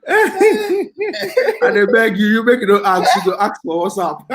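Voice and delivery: pleading through real laughter, high and stretched out; laughing. Real, not acted. Friends joking on live stream.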